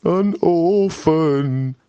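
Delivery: put-on slow mournful sigh over a baby; pitying. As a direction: stretch it out with pity. Bill pities the baby.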